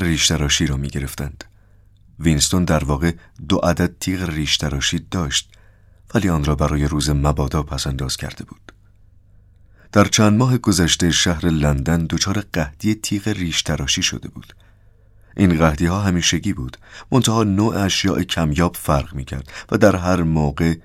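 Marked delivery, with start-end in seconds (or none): none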